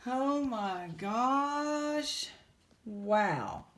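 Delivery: amazed. Real, not acted.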